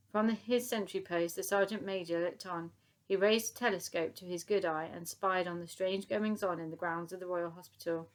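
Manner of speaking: plain reading voice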